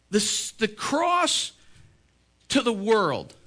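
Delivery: soaring pulpit climax; preaching at full force. Acted. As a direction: build to the glory of the cross. Sermon climax about the cross.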